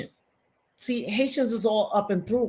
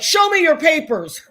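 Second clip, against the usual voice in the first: mocking laughing officer; 6 semitones higher, 5 dB louder than usual. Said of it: "walk in and be like".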